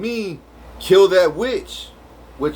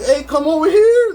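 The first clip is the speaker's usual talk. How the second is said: high, loud call across the way; retold shout to come over; 12 semitones higher, 6 dB louder than usual.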